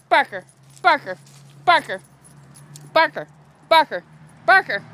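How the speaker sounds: short, punchy name calls with falling pitch; repeated calling. Real, not acted. Owner calling dog Barker repeatedly, short loud calls. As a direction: call the dog's name loudly, over and over.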